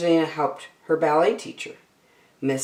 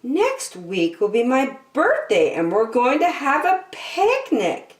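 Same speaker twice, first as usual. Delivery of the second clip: put-on high, bright little mouse girl voice, higher up; excited announcement; 10 semitones higher than usual. Young mouse telling her friend about the birthday, high and eager. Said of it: "Angelina announced to Alice".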